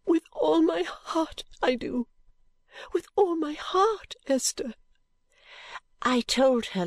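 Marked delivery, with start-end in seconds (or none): 0.1-4.7 high, slow, tearful avowal; understated sob on a raised, drawn-out voice; sobbed out. A narrator's reading. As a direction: sob it out slowly, voice lifted high. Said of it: "and then sobbed out".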